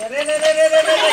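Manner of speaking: loud repeated calls to break it up; shouting to stop. Real, not acted. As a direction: bellow it to stop a scuffle. Stopping a fight.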